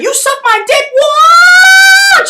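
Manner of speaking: very high, squealed mock taunt; shrieky put-on voice rising into a loud yell; mock angry woman. Woman bursting in shooting, high mock screech.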